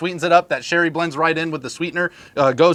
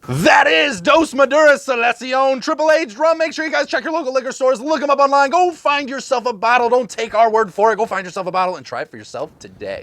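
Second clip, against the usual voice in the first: announcer outro; 8 semitones higher than usual. Acted. Hosts wrapping up the episode for viewers, big announcer sell. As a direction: sell it like a TV pitchman.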